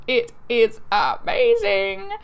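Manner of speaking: each word of "It is amazing" drawn out slow and loud; emphatic rave. Real, not acted. Vlogger raving to viewers about Denny's, emphatic and drawn out. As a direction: stretch "amazing" right out, punched hard.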